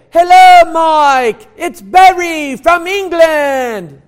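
high, booming British phone greeting; Barry's British accent. Retold or imitated. Old man phoning his pastor, loud pronounced British accent. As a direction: shout it out in a drawn-out, hammed-up British accent. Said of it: "he spoke in a very pronounced British accent".

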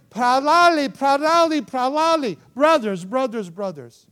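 high, loud cries after someone; imitated urgent calling. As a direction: call out urgently, like the women catching up. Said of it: "They're out of breath".

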